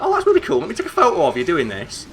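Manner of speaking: high squeaky mock gushing, then settling; mock excited girl. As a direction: imitate an overexcited friend gushing. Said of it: "what she'd do is like".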